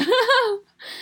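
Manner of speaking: high, loud laugh; laughing off the scam; embarrassed laugh. Real, not acted. Laughs at being scammed. (尴尬大笑)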